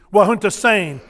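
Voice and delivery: Wahunta Sane said clearly with a falling Sane; formal Twi greeting. Shown as a demonstration. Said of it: "I love it in Twi".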